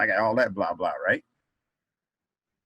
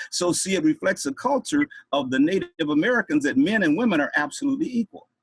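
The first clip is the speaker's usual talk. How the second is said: plain reading voice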